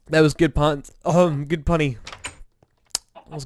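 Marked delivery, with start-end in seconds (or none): none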